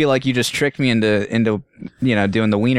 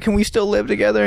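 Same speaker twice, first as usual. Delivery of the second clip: mock-hurt plea, pitched up; hurt pleading; 8 semitones higher than usual. To conjoined friend, a mock-hurt high plea. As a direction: whine it like a wounded friend.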